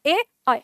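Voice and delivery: distinct ए, then अ as an ai glide; contrasting e and ai. Shown as a demonstration. Teacher shows they differ, ए pure and अ as ai. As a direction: keep ए pure and widen अ into ai. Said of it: "दोनों का उच्चारण अलग अलग".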